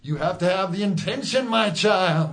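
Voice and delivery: high preacher voice; preaching. Acted. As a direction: preach it to your flock. Mock preacher voice.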